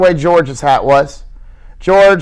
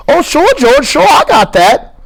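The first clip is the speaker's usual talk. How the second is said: put-on high stammering childlike plea; Lenny, earnest; 12 semitones higher, 3 dB louder than usual. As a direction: insist like a scared child. Lenny's held voice.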